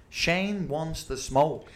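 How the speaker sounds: slow, drawn-out bad American accent; terrible American accent. Host hypes Shane's challenge to US listeners, terrible American accent. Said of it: "That was the worst accent I've ever heard".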